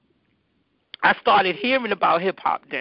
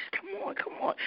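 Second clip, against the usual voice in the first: whispered, coaxing come on; urging whisper; 9 semitones higher, 14 dB quieter, faster than usual. Retold or imitated. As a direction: hurry her along in a hush. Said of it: "He was like".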